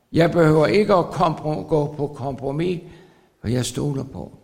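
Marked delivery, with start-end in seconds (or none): none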